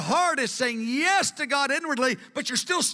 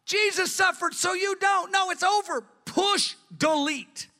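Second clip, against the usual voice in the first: emphatic shout; 8 semitones higher than usual. Retold or imitated. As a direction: shout down the guilt with a firm retold no. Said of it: "I said".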